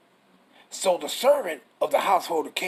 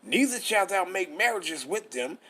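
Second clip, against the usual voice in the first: normal talking voice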